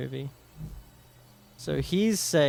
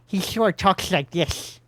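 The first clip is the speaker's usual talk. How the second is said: slow lisping cartoon sloth voice; Sid voice; 4 semitones higher, slower than usual. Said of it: "I could do Sid's voice".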